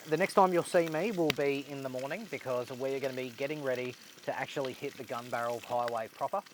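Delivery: normal talking voice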